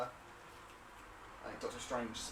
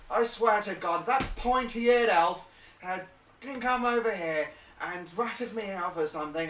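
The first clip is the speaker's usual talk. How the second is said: loud, raised indignant grumbling; angry rant; 7 semitones higher, 8 dB louder than usual. Acted. Man ranting about the elf, loud anger. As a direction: rant loudly about whoever told on you.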